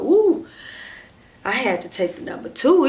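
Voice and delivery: high, loud, exaggerated "ooh"; mock exclamation. Boyfriend coming out of the restroom making excuses, mock exclaiming. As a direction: imitate his overdone relief, high and loud. Imitating man leaving the restroom.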